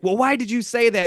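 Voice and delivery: accusing a friend during Chameleon, in a high, mock-indignant voice. Arguing in a game of Chameleon.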